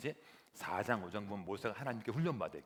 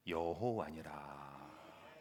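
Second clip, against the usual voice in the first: very slow, lower, drawn-out proclamation; solemn God voice; 8 semitones lower, 6 dB quieter, much slower than usual. Acted. God answering Moses, slow solemn proclamation. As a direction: declare it slowly like God.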